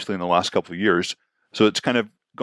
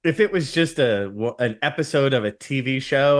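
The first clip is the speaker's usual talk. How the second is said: ordinary talk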